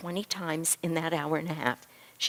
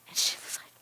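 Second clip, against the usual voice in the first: ordinary talk, nothing marked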